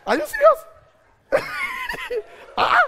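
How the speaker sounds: high loud disbelief; incredulous. Real, not acted. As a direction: shout it in disbelief. Incredulous outburst.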